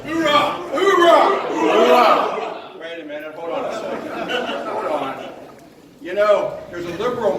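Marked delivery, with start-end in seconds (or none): 0.1-2.8 shouted military oorah, higher; loud gung-ho soldier. Acted. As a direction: bark out the oorah like a marine. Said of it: "When I say "oorah," you give me an "oorah".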